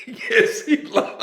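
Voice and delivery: high squeal through laughter; laughing mock yes. Mocking submissive men, cracking up. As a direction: laugh out the punchline.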